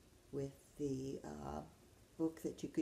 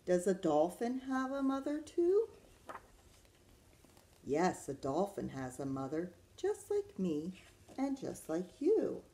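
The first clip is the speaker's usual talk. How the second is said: put-on reading to children; 4 dB louder than usual. Teacher reads Does a Kangaroo Have a Mother Too, lilting storytime voice. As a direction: read it to little ones, swooping the pitch.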